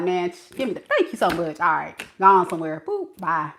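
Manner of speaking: low, dismissive send-off; mean brush-off. Retold or imitated. Woman dismissing the man she likes, mock mean. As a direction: drop the voice and shoo them away. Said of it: "I'ma be so mean to you".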